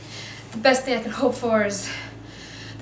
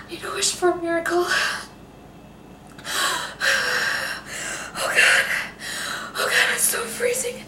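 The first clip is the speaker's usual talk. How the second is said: freezing panic; 8 semitones higher than usual. Acted. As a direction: cry out in terror of freezing. Freezing, panicking alone in the cold.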